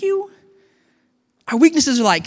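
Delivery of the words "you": a stunned, high questioning echo; incredulous thank you. Acted. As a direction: thank you rising sharply high, disbelieving.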